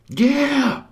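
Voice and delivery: a full, high triumphant yell; screaming. Retold or imitated. Kraft screaming in triumph after teleporting, loud and high. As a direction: scream it out like you just won the game. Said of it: "he screams".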